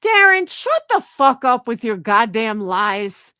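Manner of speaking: loud, angry telling-off; angry rebuke. Real, not acted. Speaker rebukes Darren over his lies, angry. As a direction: tear into a liar, loud and forceful.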